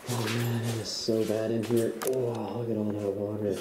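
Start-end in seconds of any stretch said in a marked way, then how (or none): none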